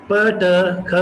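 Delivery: ordinary talk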